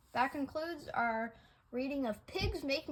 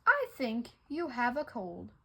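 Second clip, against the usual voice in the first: plain reading voice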